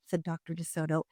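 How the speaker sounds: usual speaking voice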